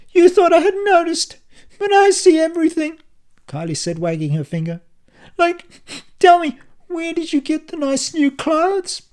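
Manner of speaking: high, loud, sickly sweet taunt; syrupy voice. A narrator's reading. Kylie taunting her husband in a syrupy voice. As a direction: pour on the sarcastic syrup. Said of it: "Her voice was as thick as syrup".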